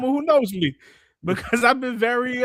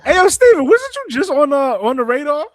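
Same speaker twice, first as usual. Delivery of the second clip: loud, high-pitched call for attention; 6 semitones higher, 7 dB louder than usual. Real, not acted. Streamer hailing a viewer in chat, calling out loudly. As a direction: holler it up high to someone.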